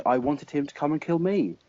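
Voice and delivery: normal talking voice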